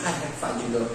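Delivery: imitating Meires with a soft, worn-out sigh; weary sigh. Meires tiring of a repeated point, soft weary sigh. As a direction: breathe it out, tired of hearing it. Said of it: "ela vai bater uma tecla comigo e vai dizer".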